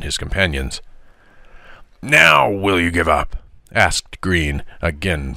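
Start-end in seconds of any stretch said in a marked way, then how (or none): 2.0-3.4 hard opening NOW, then falling; insistent bully's challenge; bullying demand. A narrator's reading. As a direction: demand surrender like a schoolyard bully. Bully demanding surrender in fight.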